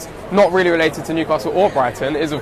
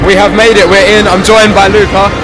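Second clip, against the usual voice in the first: loud over stadium noise; shouting over crowd; 3 semitones higher, 8 dB louder than usual. Real, not acted.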